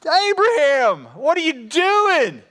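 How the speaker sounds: high, slow, incredulous rebuke; slow exasperated divine scolding; God scolding. Retold or imitated. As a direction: call him out slowly in disbelief. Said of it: "God goes".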